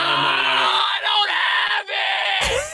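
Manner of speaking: high, slow, drawn-out wail; dramatic wail. Real, not acted. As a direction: howl it out in mock despair. Losing at Uno, dramatic wail.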